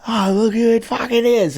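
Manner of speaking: put-on high, rising drunken crow; drunk heckler. Drunk heckler spotting the comics at dinner, slurred and high. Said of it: "he's all fucking drunk".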